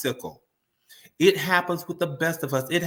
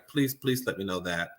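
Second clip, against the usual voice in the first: normal talking voice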